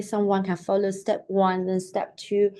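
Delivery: usual speaking voice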